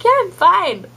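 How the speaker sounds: high-pitched mock reassurance; tearful brush-off, imitated. Tearing-up wife reassuring her concerned husband, high imitated brush-off. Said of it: "I'll be tearing up".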